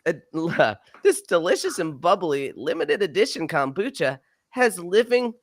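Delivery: put-on high, bouncy little beach kid; kid surfer voice. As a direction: read it like an excited kid at the beach. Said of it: "I'm gonna do, like, a kid surf-, surfer".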